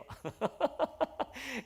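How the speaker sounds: a high burst of laughter; laughing. Real, not acted. Preacher laughing at the slow pastor story. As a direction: laugh at your own story.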